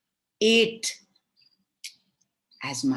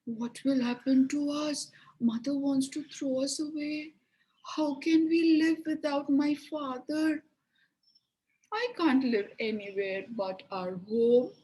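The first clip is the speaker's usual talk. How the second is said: higher, pleading, on the verge of tears; sobbing; 8 semitones higher than usual. Acted. Gretel crying to her brother Hansel, tearful. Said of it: "She started sobbing".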